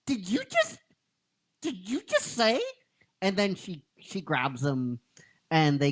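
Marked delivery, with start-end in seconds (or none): 0.0-2.6 stunned disbelief. Retold or imitated. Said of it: "he goes".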